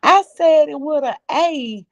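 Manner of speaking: high-pitched mimicry of an insistent caller. Co-host retelling a panel caller's protest, high mock-insistent imitation.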